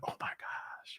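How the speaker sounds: breathy hushed oh my gosh; whispered despair. Retold or imitated. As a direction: let it slip out as a broken whisper. Imitating his crying moment.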